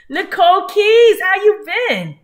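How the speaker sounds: high, loud hype greeting; excited greeting. Real, not acted. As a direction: shout out viewers by name. Loud greeting to chat viewers.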